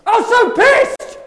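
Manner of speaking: high, loud, slow yell; angry shout. Pretend. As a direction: scream it out furious. Mock rage.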